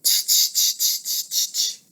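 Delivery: soft whispered tch tch sounds; monkeys tutting. Acted. Monkeys mocking the peddler with whispered tutting. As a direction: tut back like teasing monkeys. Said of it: "and said".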